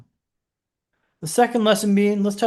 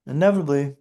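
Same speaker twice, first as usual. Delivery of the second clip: usual speaking voice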